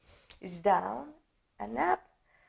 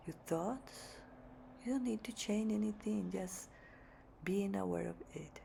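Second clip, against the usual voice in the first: very soft, hushed meditation voice; guided meditation; 16 dB quieter than usual. Real, not acted. Yoga teacher settling the class to meditate, hushed guided meditation. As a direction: guide them gently into stillness, leaving long pauses.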